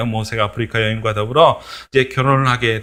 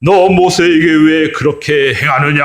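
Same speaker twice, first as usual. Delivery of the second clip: slow, louder stern rebuke; God scolding Aaron and Miriam, slow and stern; God rebuking; 3 semitones higher, 4 dB louder, slower than usual. Acted. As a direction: rebuke them slowly from the cloud. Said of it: "막 야단을 치기 시작을 하는 거예요".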